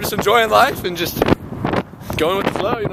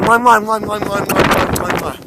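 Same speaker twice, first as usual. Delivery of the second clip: kissing sounds. Shown as a demonstration. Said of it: "That's how you make out".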